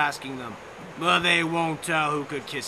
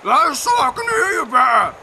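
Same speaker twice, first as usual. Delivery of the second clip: louder put-on wolf voice; 7 semitones higher, 9 dB louder than usual. A narrator's reading. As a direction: growl it out as the big bad wolf. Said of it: "the wolf says".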